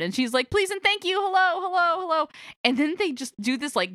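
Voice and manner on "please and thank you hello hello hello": high-pitched little-girl imitation. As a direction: mimic a child reciting her English words, high. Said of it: "So the little girl is like".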